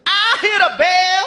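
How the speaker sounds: grumpy clerk offstage. Acted. Clerk voiced from back.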